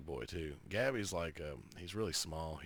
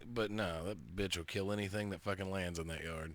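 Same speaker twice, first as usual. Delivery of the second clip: ordinary talk, nothing marked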